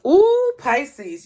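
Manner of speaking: a thrilled gasp at the card; delighted ooh; excited ooh. Real, not acted.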